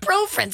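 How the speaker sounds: high "Profen" said as PRO-frend; extra-R profen. Shown as a demonstration. Cohost mocking the added R, high "Profen" as PRO-fren. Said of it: "Stop throwing R's in".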